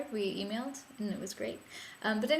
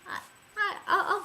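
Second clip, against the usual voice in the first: choked-up stammer; 12 semitones higher than usual. Real, not acted. Stammering about blaming friend.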